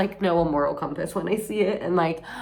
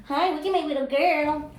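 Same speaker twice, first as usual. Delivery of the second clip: pet talk; 10 semitones higher than usual. Real, not acted. Greeting her pet.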